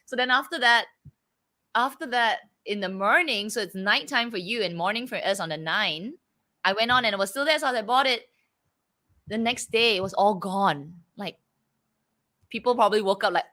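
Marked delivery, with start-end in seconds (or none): none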